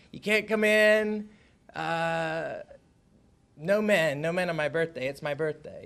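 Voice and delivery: put-on repeating the child's words to the lady; repeating the girl's words. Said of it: "I said".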